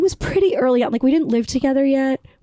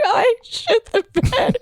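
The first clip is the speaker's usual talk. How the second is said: high laughing near tears; high, slow laugh-cry; 10 semitones higher, slower than usual. Real, not acted. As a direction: confess it while laughing and half crying. Laughing-crying confession.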